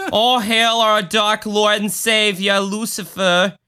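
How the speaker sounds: put-on chatty Long Island Satanist, accent laid on thick. Cult host offering coffee and hailing Satan, mock Long Island accent. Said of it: "they would all have the Long Island accent".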